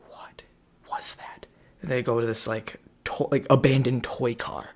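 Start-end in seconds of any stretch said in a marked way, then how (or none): 0.1-1.5 whispered. Retold or imitated. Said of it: "And they're like".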